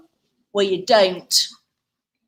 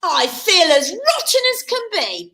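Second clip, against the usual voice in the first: crying out; 8 semitones higher, slower than usual. A narrator's reading. Cinderella complains to the fairy, crying out. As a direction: complain theatrically, starting high. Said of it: "cried Cindy".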